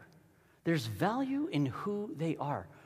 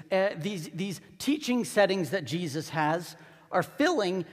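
ordinary delivery

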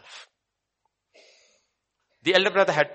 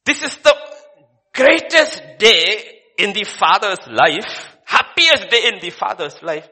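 higher, louder, slow stretched proclamation; exultant preaching; 6 semitones higher, 11 dB louder than usual. Acted. Preacher exalts the father's happiest day, exultant. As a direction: lift it up as the greatest day.

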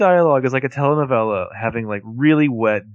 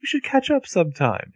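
pitched-up British accent, imitating him; British accent impression; 11 semitones higher than usual. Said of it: "He does have that accent".

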